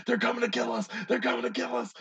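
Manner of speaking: high-pitched mock-panicked cry; hammed-up alarm, pitched high. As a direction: play a paranoid man freaking out. Imagines the ex-cop panicking.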